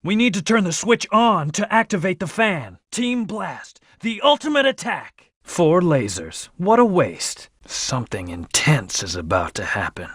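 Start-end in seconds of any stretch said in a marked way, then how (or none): 2.9-5.3 high, heroic battle cry; attack call. Acted. Game hero launches the team's ultimate attack, attack call. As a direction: announce the big move with excitement.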